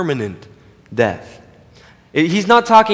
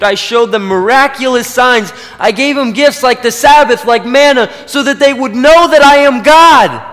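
climbing higher and louder to a ringing peak; Jesus' grief rising into a loud proclamation; building to a cry; 7 semitones higher, 7 dB louder than usual. Acted. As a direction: swell from pleading to a full pulpit cry. Jesus voiced, building indignation.

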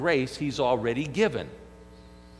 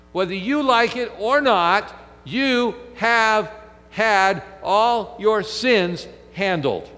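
louder, insistent pulpit delivery; forceful preaching; 4 semitones higher, 3 dB louder than usual. Acted. Pastor proclaiming grace to the congregation, forceful preaching. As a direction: drive home that every sin is handled.

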